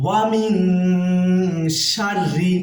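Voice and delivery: slow, melodic chanted recitation; reciting Surah Falaq for students; Quran recitation. Shown as a demonstration. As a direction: stretch each word in chant. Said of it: "ইখফা গুণা হবে".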